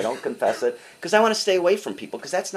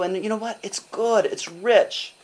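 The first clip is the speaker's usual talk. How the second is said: ordinary talk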